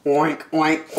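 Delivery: oinks with piggy snorts; storybook pig noises; pig's grunt. Acted. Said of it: "grunted the pig".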